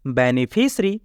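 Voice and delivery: normal talking voice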